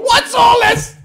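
very high, very loud squealed impression; mimicking the catchphrase. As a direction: squeal the catchphrase back at the video. Echoing the clip's catchphrase.

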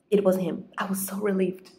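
normal talking voice

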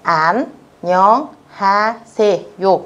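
"Annyeonghaseyo" very slowly, a gap after each syllable. Shown as a demonstration. Teacher shows students how to read the greeting, very slow separate syllables. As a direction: break it up: an, nyeong, ha, se, yo. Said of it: "Các bạn chú ý cách đọc của nó nhé".

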